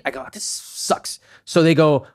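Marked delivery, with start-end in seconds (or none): 0.3-1.2 whispered aside. Retold or imitated. Her own retold aside.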